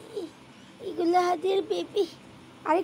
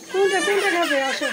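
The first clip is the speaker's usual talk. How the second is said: laughing question; 3 semitones higher, 6 dB louder than usual. Real, not acted. Laughing while feeding baby.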